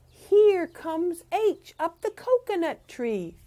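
high, loud and slow; excited announcing. A narrator's reading. Teacher reading to kids, building excitement. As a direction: announce it like a big arrival. Read-aloud refrain.

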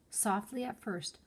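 ordinary delivery

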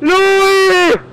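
high loud call across the street. Real, not acted. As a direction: shout the name out to someone. Ruft einem Fahrer zu. (laut hoch rufen)

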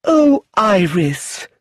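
aunt crying out in dismay at the news. Acted. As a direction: draw the cry out in distress. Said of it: "Lucilla cried".

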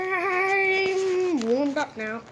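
words shaking with cold; shivering. Pretend. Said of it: "You seem like you're still shivering".

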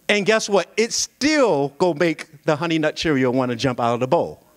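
usual speaking voice

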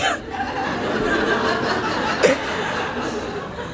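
airy high giggle, almost silent; a breathy giggle at yourself. Real, not acted. Laughs at her own joke.

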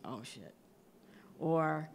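ordinary talk

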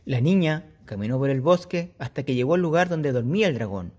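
put-on lively read-aloud for kids; storytelling voice. A narrator's reading. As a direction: tell it like a bedtime story. Teacher reads story aloud.

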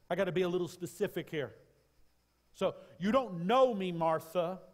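high, loud climactic preaching cry; full-throated revival preaching; preaching at full force. Acted. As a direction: lift it to a soaring shout from the pulpit. Preaching, voicing Jesus.

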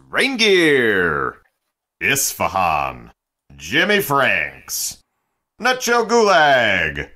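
grand mock-epic announcer calling the roster; booming announcer. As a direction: boom out the names like a ring announcer. Announces the hour's room list.

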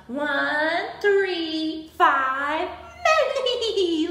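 loud count breaking into acted laughter; laughing. A narrator's reading. Cheetah counting badly for Abigail, acted laughing. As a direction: count boldly, then laugh through the last word. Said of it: "laughed Cheetah".

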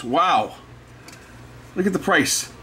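ordinary talk, nothing marked

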